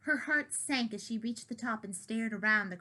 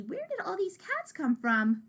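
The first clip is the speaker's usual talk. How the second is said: alarmed question; 8 semitones higher than usual. Acted. Kitty asks in alarm.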